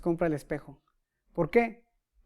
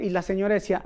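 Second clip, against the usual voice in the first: ordinary talk, nothing marked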